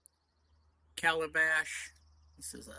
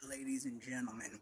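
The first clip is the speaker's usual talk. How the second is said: ordinary talk, nothing marked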